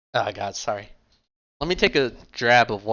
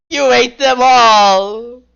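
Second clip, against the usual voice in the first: hammed-up howl of despair, much higher and louder, very slow; desperate dramatic cry; 12 semitones higher, 12 dB louder, much slower than usual. Rook mourning the eaten tacos, hammed-up anguished howl. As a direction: wail it like a ruined hero at the heavens. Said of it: "dramatically raises his arms in desperation".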